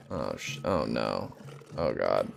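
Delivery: hushed panicked pleading; terrified whisper. Real, not acted. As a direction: breathe it out in dread.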